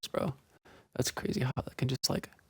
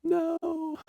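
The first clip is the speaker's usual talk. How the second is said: anguished cry; 12 semitones higher, 5 dB louder, much slower than usual. Real, not acted. Death screen reaction.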